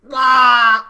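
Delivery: sudden roaring yell of a brute; monster roar. Retold or imitated. Mocking Jinder's new look with a loud roar. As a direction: bellow it like a roided-up monster. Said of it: "it's just like".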